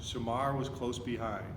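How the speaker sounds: usual speaking voice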